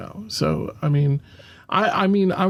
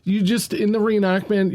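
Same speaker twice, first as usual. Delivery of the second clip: ordinary talk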